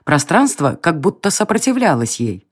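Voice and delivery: ordinary delivery, nothing marked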